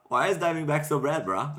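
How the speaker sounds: lightly done fake Hawaiian accent. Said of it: "You're doing a fake Hawaiian thing".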